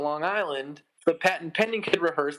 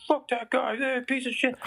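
higher mock-scornful voice of angry fans; tossed-off mock insult, pitched up; imitating texters; 8 semitones higher, slower than usual. People texting him about the drummer, in mock scorn. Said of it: "most of it was like".

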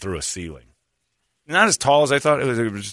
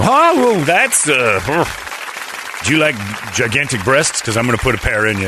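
goofy surgeon voice; 3 semitones higher, 5 dB louder than usual. Pretend. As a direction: play the doctor loopy under sedation. Mock surgeon under sedation.

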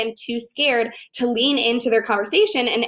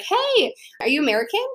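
high, eager hail to strangers; hopeful, high-pitched hello; bright call-out; 8 semitones higher than usual. Retold or imitated. Leaning over to strangers.

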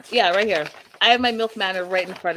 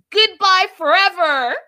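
high, loud melodramatic farewell; hammed-up yell as you storm out; storming-out shout; 10 semitones higher, 9 dB louder than usual. Host imitating Darcy walking out on Georgie, dramatic shout. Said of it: "she storms out and says".

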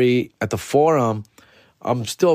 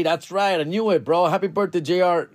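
usual speaking voice

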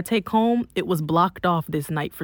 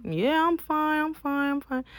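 tearful reassurance; 9 semitones higher than usual. Retold or imitated. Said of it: "and I'm in tears".

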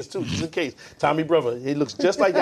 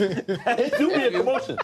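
laughing; 5 semitones higher, slower than usual. Real, not acted. Laughing joke.

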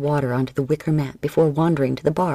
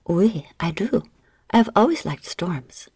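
whisper; 3 semitones higher than usual. Acted. Seductive widow flirting with Binge.

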